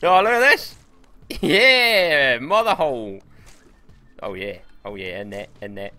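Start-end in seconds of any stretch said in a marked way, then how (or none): 0.0-3.2 high, loud whoop of delight; real excited shout. Real, not acted. Gamer whooping at what he just found, excited shout. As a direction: shout it out, thrilled by the find.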